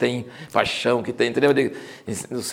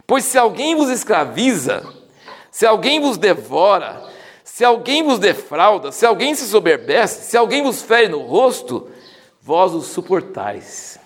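slow and higher, leaning on each vos; slow ironic scripture reading, vos punched; ironic emphatic reading; 6 semitones higher, slower than usual. Acted. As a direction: stress every vos, mocking their tolerance. Reads Paul's ironic list.

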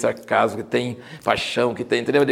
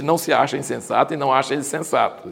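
ordinary talk

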